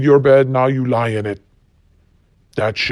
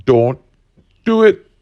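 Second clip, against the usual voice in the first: higher, louder warning shout; sudden warning shout; 7 semitones higher, 5 dB louder than usual. Acted. Warning after gunpowder line.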